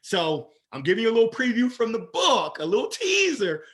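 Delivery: loud, high, building "I am somebody"; rising motivational fervor. Real, not acted. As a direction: lift the room with belief.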